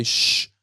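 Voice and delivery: brief "shh" set into the sentence; quick illustrative shushing sound; demonstrated shush. Shown as a demonstration. Public speaker quoting what not to say, brief shush.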